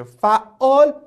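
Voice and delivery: فعال said with a doubled, stretched middle: fa-''aal; فعال with a geminated ain; correct pronunciation. Shown as a demonstration. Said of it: "لا، به این شکل".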